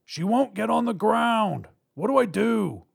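high, loud, exasperated shout; calling out. Acted. Robber to partner about stubborn hostage, high frustrated shout. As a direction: shout across the room for instructions.

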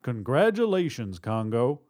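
ordinary delivery

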